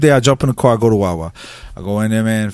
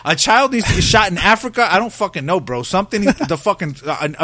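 ordinary talk

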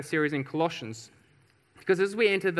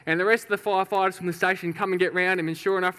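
ordinary talk

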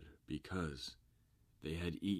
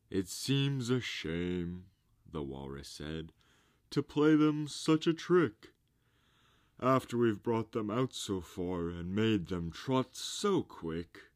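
higher, loud, fake regret; mock-sorry walrus; 4 semitones higher, 7 dB louder than usual. A narrator's reading. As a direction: feign pity like a walrus.

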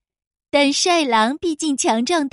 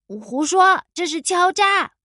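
higher, indignant protest; indignant shout; 4 semitones higher than usual. A narrator's reading. Crow protests the wolf's entrance fee, indignant. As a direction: cry out in outrage. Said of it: "花袜子气愤地喊道".

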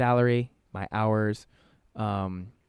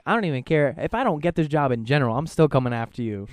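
louder, higher joking threat; a friend warned in a mock-angry raised voice; 5 semitones higher, 6 dB louder than usual. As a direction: lean in like a half-serious threat. Retold threat to reference.

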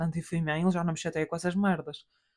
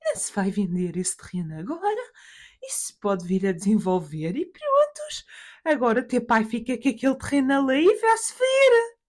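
mother's voice; 6 semitones higher, 4 dB louder than usual. Acted. Mother objects to selling land, mother's voice. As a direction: imitate mum insisting, voice climbing high at the ends. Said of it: "Que ela fala assim".

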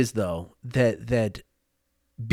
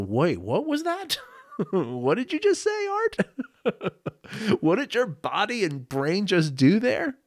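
amused self-catch; 7 semitones higher than usual. Retold or imitated. Catching himself, amused self-questioning.

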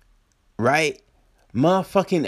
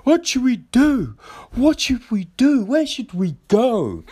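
mock panicked passenger; 10 semitones higher than usual. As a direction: parody the helpless crowd. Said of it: "it's just like".

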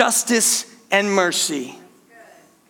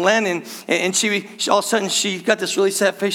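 ordinary talk, nothing marked

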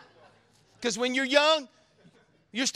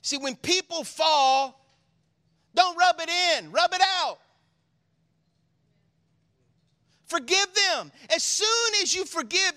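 louder, rising appeal to forgive; preaching at full force; 8 semitones higher, 5 dB louder than usual. Real, not acted. Pastor urging his church to forgive, preached loud. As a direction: preach it out to the congregation.

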